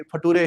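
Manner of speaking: "फटूरे" said fa-too-ray for "future"; student's phonetic misreading, "फटूरे"; mispronounced future. Acted. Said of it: "Future, he's saying future।".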